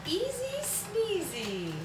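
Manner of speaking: high, slow singsong. Pretend. Making coffee for viewers, high slow singsong. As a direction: say it like a cutesy rhyme.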